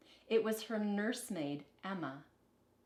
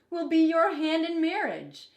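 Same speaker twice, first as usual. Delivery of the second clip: stern king; 9 semitones higher, 9 dB louder than usual. Acted. King answers his daughter.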